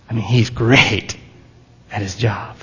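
half-whispered sarcasm about how great he thinks he is; mock boasting. Mocking Balaam's self-image.

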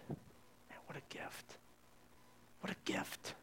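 hushed whisper in wonder; awed whisper. Real, not acted. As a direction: breathe it out in awe. Awestruck aside about God's gift.

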